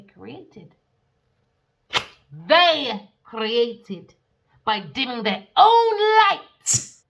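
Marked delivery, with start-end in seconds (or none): 2.5-7.0 preacher-style loud rebuke; loud denouncing. Acted. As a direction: call out the liars loudly. Denouncing liars.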